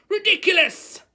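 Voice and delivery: high, loud yelled spell; pupil's full-voiced spell cry. A narrator's reading. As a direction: yell the incantation with all force. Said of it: "yelled Dean".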